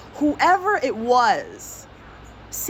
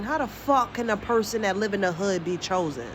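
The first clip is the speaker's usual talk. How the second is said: usual speaking voice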